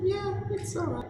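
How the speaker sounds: very high, far-off calling voice; psychic sister voice. Acted. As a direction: call out as the lost sister from inside the brain. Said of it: "you hear the voice of your sister".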